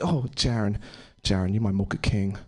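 lightly voiced sultry partner, low; a hint of put-on seduction, pitched low; mock dirty talk.

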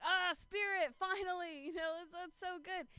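high, relieved sigh of joy; relieved greeting. Retold or imitated. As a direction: greet him like a lifesaver. Relieved greeting to friend Spirit.

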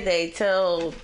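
usual speaking voice

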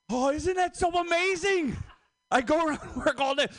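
very high excited squeal; giddy delight. Real, not acted. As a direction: gush in a high, thrilled voice. Gushing over Japanese name.

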